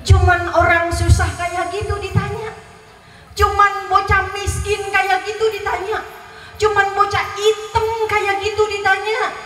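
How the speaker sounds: high-pitched imitation of a contemptuous rich neighbour; mock scornful sneer. Rich man belittles child to guest.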